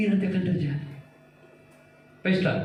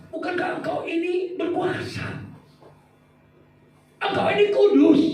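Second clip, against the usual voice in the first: angry questioning; 12 semitones higher than usual. Acted. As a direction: demand an answer from God indignantly. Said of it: "dia marah sama Tuhan". (Habakuk menuntut jawaban Tuhan, tinggi dan keras di akhir)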